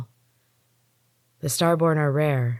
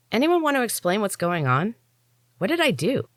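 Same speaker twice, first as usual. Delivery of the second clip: higher, puzzled questioning voice; baffled, asking what went wrong; confused; 7 semitones higher than usual. A narrator's reading. Confused narrator asks.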